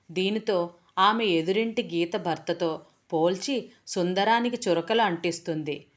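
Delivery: plain reading voice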